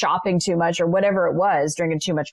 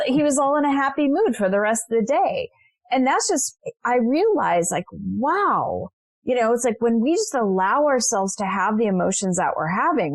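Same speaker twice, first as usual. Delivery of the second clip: ordinary talk, nothing marked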